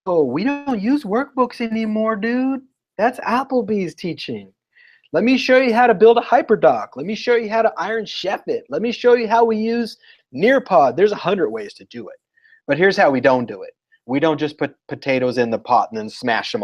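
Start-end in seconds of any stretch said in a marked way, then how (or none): 0.1-11.0 high, breezy know-it-all teacher voice; mock laid-back expert teacher; confident hip teacher. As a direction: brush off workbooks like a cool insider. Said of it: "they need to go like".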